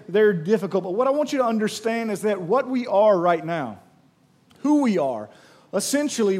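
usual speaking voice

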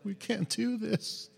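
soft, slow voice of defeat; discouraged. Acted. The people a chapter later, discouraged and giving up. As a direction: give up, quietly and slowly.

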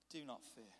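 low, soft, steadying word; calm reassurance. Acted. Elisha replying to his frightened servant, calm reassurance. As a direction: settle the panic, low and quiet.

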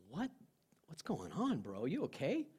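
soft, swooping pitch on each question; startled concern. Retold or imitated. Driver to weeping friend in the car, startled concern. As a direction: voice the baffled check on a friend, gently.

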